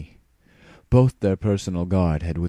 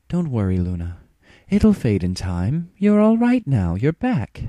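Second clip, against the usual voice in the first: comforting Celestia; 7 semitones higher, 8 dB louder than usual. Acted. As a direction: soothe your sister gently.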